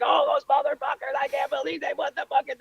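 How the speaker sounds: very high mock rant; imitated screaming fan. Comic playing a fan after a lost pennant, mock screaming. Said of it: "the guy is screaming about the Red Sox".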